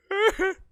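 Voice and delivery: laugh. Real, not acted. Laughs at own story.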